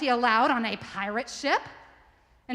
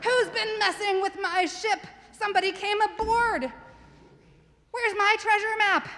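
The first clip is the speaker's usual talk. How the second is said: high, loud hammed-up pirate growl; a pirate storming aboard her ship, hammed-up and high; pirate captain; 11 semitones higher, 4 dB louder than usual. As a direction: bellow it like an outraged pirate captain. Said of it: "She said".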